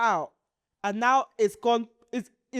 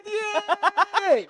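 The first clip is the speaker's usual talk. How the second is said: high, loud mock cry; imitated pained yelp; 9 semitones higher, 5 dB louder than usual. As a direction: yelp as if pressed where it hurts, laughing. Retold cry when doctor pressed down.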